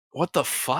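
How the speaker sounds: hushed mock disbelief, as a dad would react; dad's muted disbelief. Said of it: "he'd be like".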